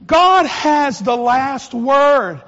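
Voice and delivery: slow, loud, every word weighted; loud proclamation. Real, not acted. Preacher back at the pulpit, slow loud proclamation. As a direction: proclaim it over the whole church.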